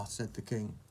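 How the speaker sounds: ordinary delivery, nothing marked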